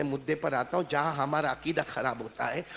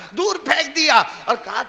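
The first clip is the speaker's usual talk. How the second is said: ordinary delivery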